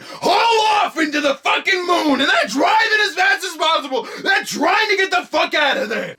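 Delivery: high-pitched yelling, hammed-up panic; frantic shouted narration. A narrator's reading. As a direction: shout it like a mad getaway. Frantic retelling of the getaway.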